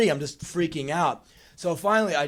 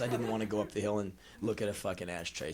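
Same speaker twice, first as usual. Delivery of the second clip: ordinary talk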